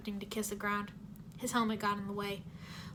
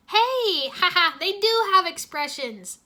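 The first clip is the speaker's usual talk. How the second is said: gleeful laughing exclaim; 8 semitones higher, 11 dB louder, slower than usual. Acted. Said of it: "Jay laughed".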